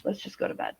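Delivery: whispered plea to the armed husband. Retold or imitated. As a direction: hush him toward bed in a whisper. Just pleaded with him.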